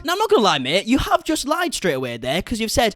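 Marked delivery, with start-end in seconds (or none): none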